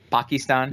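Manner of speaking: "Pakistan" with broad ah vowels, PAH-kee-stahn; "Pakistan" pronounced the Obama way, with long ah; PAH-kee-stahn pronunciation. Retold or imitated. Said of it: "Does that upset you".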